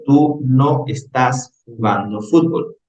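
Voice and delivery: Spanish version read slowly; slow Spanish interpretation. Shown as a demonstration. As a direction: give the slow translation to the class. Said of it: "En mi interpretación, yo digo". (traducción leída despacio)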